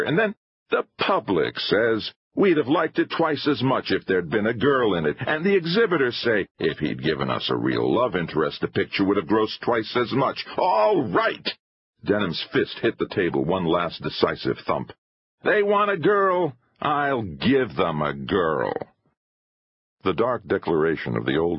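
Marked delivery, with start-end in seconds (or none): 0.0-11.6 high-pitched, bitter rant; indignant filmmaker venting; exasperated showman. A narrator's reading. 15.4-18.7 high start, dropping to slow determination; resolute, drawn-out finish; defiant vow. A narrator's reading. Filmmaker makes a heated vow.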